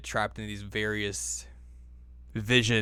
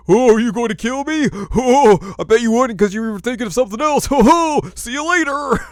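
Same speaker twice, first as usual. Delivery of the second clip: much higher and louder, hammed-up mocking taunt; taunting Kikyo; 14 semitones higher, 12 dB louder than usual. Kikyo taunting Inuyasha, loud high mocking villainess voice. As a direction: sneer and cackle like a cartoon villainess. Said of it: "She's like".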